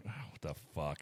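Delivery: low, very quiet mock grumble; hushed, disgusted retort; retold annoyance. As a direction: imitate her pissed-off reaction under the breath. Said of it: "She's pissed off".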